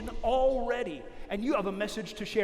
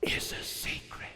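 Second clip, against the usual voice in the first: whisper; 9 dB quieter, slower than usual. Acted.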